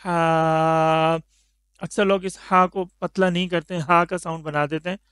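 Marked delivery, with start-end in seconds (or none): 0.0-1.2 हा breathed lightly from the middle of the throat; a clear, airy हा; drawn-out light हा; thin ha from mid-throat. Shown as a demonstration. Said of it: "हलक के दरमियान से".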